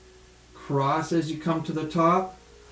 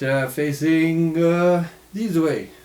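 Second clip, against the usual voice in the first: slow, louder mock Russian accent; 2 semitones higher, 5 dB louder, slower than usual. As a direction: drag out the words in a joking Russian accent. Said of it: "This is my Russian accent".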